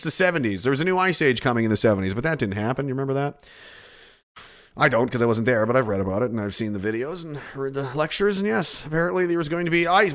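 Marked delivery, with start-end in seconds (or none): none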